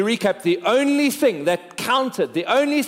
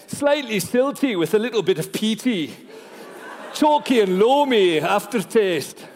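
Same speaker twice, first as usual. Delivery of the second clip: put-on whisky-tasting patter in a Scottish accent; 3 semitones higher than usual. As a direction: relish each word like a Scottish whisky taster. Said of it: "why that came out Scottish".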